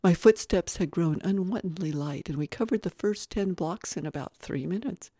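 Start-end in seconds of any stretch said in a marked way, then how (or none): none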